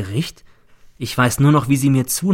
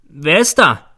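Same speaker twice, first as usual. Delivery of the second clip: loud, raised call through a door; higher, louder challenge to whoever is outside; 5 semitones higher, 7 dB louder, slower than usual. Acted. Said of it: "rufe ich durch die Türe".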